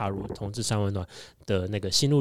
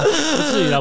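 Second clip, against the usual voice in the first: ordinary talk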